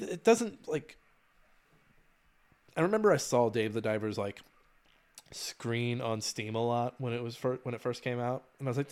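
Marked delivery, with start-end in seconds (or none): none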